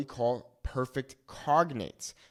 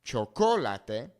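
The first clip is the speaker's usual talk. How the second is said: chocolate as cho-CO-la-teh, CO punched loud; slow chocolate with the stress on CO; wrong second-syllable stress; 5 dB louder, slower than usual. Shown as a demonstration. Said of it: "It's not chocolate".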